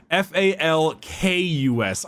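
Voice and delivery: each letter set apart; spelled out slowly. Shown as a demonstration. Spelling the corrected name for the co-host, slow letters. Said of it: "I had it written down as F A L L U S".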